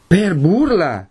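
high, slow, incredulous shout; indignant cry. A narrator's reading. Renzo rounds on the seated mother, crying out indignantly. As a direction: throw the words back in outrage. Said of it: "gridò Renzo".

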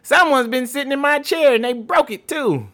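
a little cub whining; Baby Bear voice. Acted.

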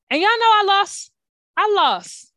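outraged exclaim. Real, not acted. Host telling listeners she lost, loud and indignant. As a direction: proclaim the loss like it's a scandal. Outraged about losing the contest.

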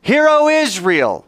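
high, drawn-out ringing call; with gusto. Shown as a demonstration. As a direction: lead the congregation in a full-voiced prayer call. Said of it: "say it with some gusto".

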